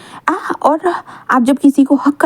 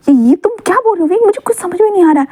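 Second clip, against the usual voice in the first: bewildered; 6 semitones higher, faster than usual. A narrator's reading. Bewildered Wang questions Wing.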